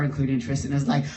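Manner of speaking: normal talking voice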